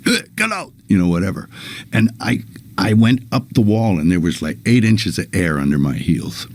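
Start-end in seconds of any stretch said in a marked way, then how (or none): 0.1-0.7 high-pitched yell at the organ; yelling at liver. Retold or imitated. Healer yelling at the patient's liver, high pitched. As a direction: squeal it out at the liver. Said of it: "he started yelling at my organ".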